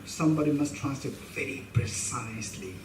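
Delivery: usual speaking voice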